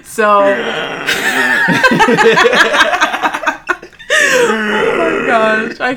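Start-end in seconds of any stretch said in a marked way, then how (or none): 0.4-4.3 high squealing laugh. Real, not acted. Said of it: "That's her mating call".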